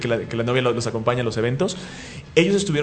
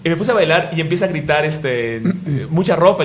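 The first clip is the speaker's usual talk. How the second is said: ordinary delivery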